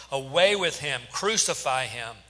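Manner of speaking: loud, emphatic crowd demand; yelling. A narrator's reading. The crowd to Pilate, yelling for crucifixion. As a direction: yell both demands, punched and falling. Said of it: "they yelled".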